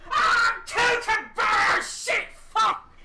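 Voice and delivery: high, drawn-out agonized shout; loud, hammed-up howling and swearing; Lawrence in pain. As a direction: yell curses as if blasted in the ear. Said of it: "And Lawrence just goes".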